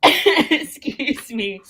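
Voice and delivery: high, slow, laughing excuse me; giggly excuse me. Real, not acted. Host trying to stop laughing before going on. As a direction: pull yourself together through giggles.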